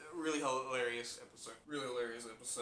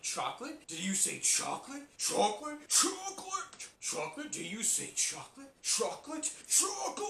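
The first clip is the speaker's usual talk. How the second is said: rising, ever higher squeal of chocolate; crazy chocolate guy; 11 semitones higher than usual. Retold or imitated. Fan imitating the crazy chocolate guy, escalating squeal. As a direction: get more unhinged each time. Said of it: "the guy that says".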